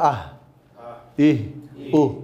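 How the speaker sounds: আহ ইহ উহ with a breathy h at the end; Arabic sound drill. Shown as a demonstration. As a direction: stop on the h, breathy. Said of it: "এই কিন্তু উচ্চারণ হবে".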